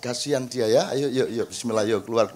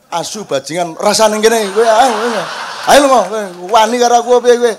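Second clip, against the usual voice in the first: furious Javanese shouting at ghosts, much louder; Javanese scolding shout; 4 semitones higher, 9 dB louder than usual. Retold or imitated. Shouting at Mussolini's ghosts in Javanese, loud furious scolding. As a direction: bark it out, rough and loud, as if scolding a spirit. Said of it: "tak bentak-bentak".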